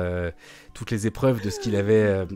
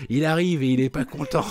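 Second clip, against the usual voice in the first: a hint of a cartoon villain, a little higher; lightly voiced threatening villain; 5 semitones higher than usual. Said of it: "Je fais hyper bien les voix de méchants".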